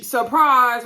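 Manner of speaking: mockingly cheery, sudden shout; mock surprise announcement. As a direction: burst it out like a party surprise. Mock announcing bad news as surprise.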